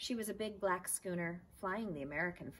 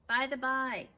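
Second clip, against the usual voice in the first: much louder yell across the pond; 8 semitones higher, 10 dB louder than usual. Acted. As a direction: call out loudly to someone far away. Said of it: "yelled the man".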